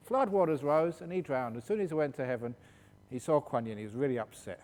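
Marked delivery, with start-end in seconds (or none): none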